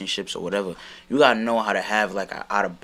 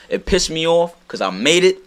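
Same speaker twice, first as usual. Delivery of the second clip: worked up; 9 semitones higher, 7 dB louder than usual. Real, not acted. Frustrated about cover art.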